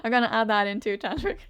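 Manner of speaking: real amusement; giggling as she talks; laughing. Real, not acted. Laughing about adding accent.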